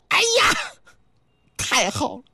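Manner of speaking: high squeal of delight, then breathless; overjoyed cry. Acted. Wukong overjoyed at being accepted, high delighted squeal. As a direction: burst out with joy. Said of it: "可把这孙悟空乐坏了".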